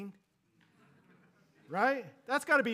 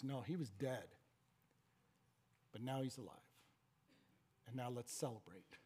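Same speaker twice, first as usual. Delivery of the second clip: tender father; 6 semitones lower, 10 dB quieter than usual. Acted. Father voiced tenderly to son.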